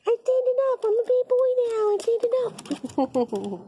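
voicing the cat. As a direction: speak for the proud kitten in a squeaky baby voice.

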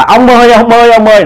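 high, urgent repeated call; crying out. A narrator's reading. Old woman running out after a shadow, crying out. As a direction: call after him, pitched well up. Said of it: "bà la".

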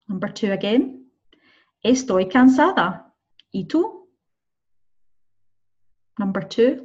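slow, clear listening-test reading; slow dictation. Shown as a demonstration. Teacher dictating answers to students, slow and clear. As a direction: sound tired, then ask back brightly. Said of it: "listening practice exercise".

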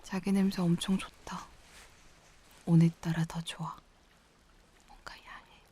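soft, close half-whisper; intimate murmur. Acted. Girlfriend holding partner close in tent, intimate whisper.

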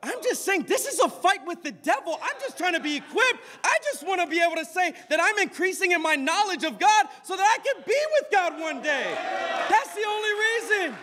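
high, pressing preacher's plea; urgent, high-pitched sermon; impassioned preaching. Real, not acted. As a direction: plead your case to the congregation, high-pitched. Preaches at full force.

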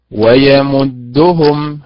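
slow ويمدهم, stress on the ميم syllable; teacher modelling the correct stress. Shown as a demonstration. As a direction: stretch ويمدهم, leaning on its middle. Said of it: "هذا الأداء المستقيم".

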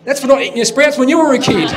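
loud, high punchline retort; mock scolding. Joke teller as wife after the slap, mock scolding. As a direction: hit back loudly as the cross wife.

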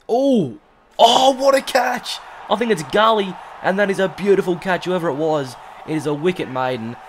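high, loud ecstatic shouting; overjoyed yelling. Real, not acted. Great catch celebrated.

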